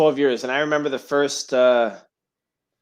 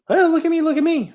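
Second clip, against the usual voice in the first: mock screaming; 12 semitones higher than usual. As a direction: mimic someone crying out for attention in a much higher voice.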